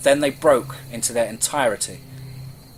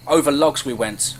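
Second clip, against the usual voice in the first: ordinary delivery, nothing marked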